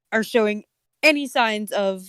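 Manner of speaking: normal talking voice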